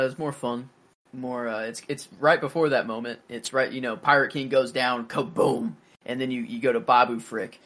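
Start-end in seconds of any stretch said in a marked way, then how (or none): none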